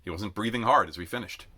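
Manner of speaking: plain reading voice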